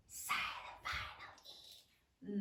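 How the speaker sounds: hushed, voiceless whisper. Shown as a demonstration. Teacher names a silent letter while sounding out, whispered.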